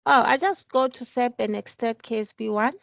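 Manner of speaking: normal talking voice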